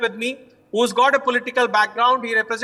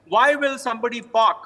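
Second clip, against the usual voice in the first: ordinary talk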